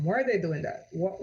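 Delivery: usual speaking voice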